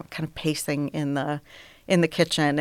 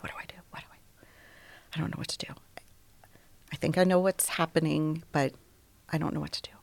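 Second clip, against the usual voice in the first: breathless frightened whisper; panicked whisper; faster than usual. Retold or imitated. Pacing alone in shock, frantic whisper. Panicking after finding husband.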